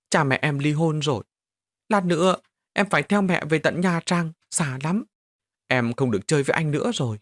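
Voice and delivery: a small boy holding back sobs, mildly voiced; tearful child. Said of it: "nức nở mà đáp". (giọng trẻ con nức nở nhẹ)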